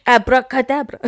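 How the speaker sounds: loud French magician flourish; a showy French abracadabra; French accent. Pretend. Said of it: "that got pretty French".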